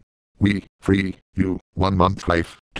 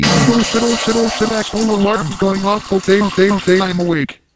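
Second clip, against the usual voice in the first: much higher, louder panic; startled awake; 12 semitones higher, 4 dB louder than usual. Acted. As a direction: jolt awake in a fluster. Woken by alarm.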